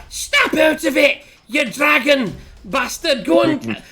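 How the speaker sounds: high, drawn-out shout; loud, high-pitched dwarf roar; in-character shout. Acted. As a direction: yell it slowly at your dazed companion. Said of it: "I'm like".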